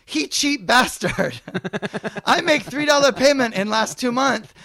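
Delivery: shrieking about a one-dollar balance; mock Vietnamese mother.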